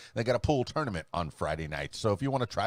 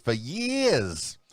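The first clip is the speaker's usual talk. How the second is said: exaggerated Northern accent, higher on "years"; 5 semitones higher than usual. Shown as a demonstration. Radio host playfully repeating himself, exaggerated Northern accent. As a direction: lean hard into a Northern "years". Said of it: "Was that kind of a northern accent?".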